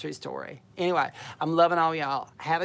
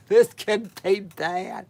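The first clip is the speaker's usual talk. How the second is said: laughing; 2 semitones higher, 8 dB louder, much slower than usual. Real, not acted. Struggling with the stream button.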